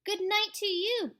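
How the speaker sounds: high, loud friendly call; calling good night. Acted. Child calling good night to monster. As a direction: call out cheerfully. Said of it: "I yelled down".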